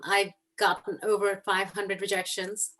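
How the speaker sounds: ordinary talk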